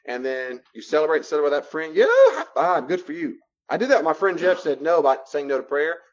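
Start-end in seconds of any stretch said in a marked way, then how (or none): 1.9-3.4 high, loud, laughing cheer. Acted. Cheering a friend who said no to fries, laughing. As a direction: celebrate them like a win.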